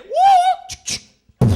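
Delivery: sudden piercing "Woo!"; high loud whoop. Retold or imitated. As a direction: whoop like a martial artist. Gun fight sound.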